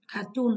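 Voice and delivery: "Cartoon" leaning on TOON; stress on second syllable. Shown as a demonstration. Teacher explaining the test answer, "Cartoon" stressed on TOON. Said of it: "trọng âm rơi vào âm tiết thứ hai".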